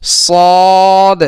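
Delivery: ordinary talk, nothing marked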